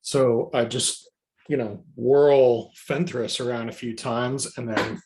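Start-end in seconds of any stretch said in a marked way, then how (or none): none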